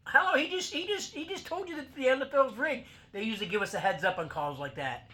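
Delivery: high, loud, incredulous outburst; mock exasperated retort. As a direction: shout it like it's obvious. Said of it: "I'm like".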